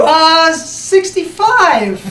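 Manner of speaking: slow, drawn-out hesitant answer; sheepish reply. Retold or imitated. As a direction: stall on the number. Son's imagined reply to mom.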